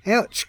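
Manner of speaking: high sharp ouch of pain; pained yelp. A narrator's reading. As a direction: yelp as the toe hits the tree. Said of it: "cried Charlie".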